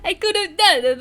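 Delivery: laughing retake. Acted. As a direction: crack up while repeating it. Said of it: "The bad Italian accent".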